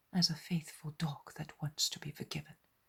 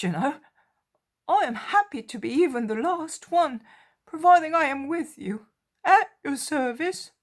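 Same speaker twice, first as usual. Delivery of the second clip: much higher, louder, fervent devotion; overjoyed Peter; 12 semitones higher, 13 dB louder than usual. A narrator's reading. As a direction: pour out joyful devotion.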